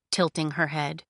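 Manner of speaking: ordinary delivery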